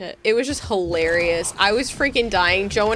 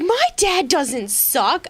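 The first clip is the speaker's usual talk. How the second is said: put-on indignant kid; 6 semitones higher, slower than usual. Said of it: "she was all up in arms".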